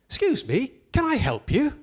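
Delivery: a prim lady asking from behind; high, polite female professor voice; woman's voice. Retold or imitated. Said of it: "a woman's voice behind me".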